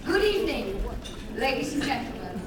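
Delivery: ordinary talk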